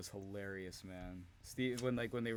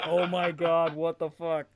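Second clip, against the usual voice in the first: much louder, higher words bursting through laughter; stifled laughter; 6 semitones higher, 11 dB louder than usual. Retold or imitated. Abyss overhearing Hogan's demand, laughing through words. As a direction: try not to crack up and fail. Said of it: "trying not to laugh".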